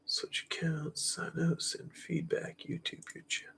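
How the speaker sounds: whispered menu reading to self; whispering. Real, not acted. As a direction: whisper the menu items under breath. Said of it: "reading menu to self".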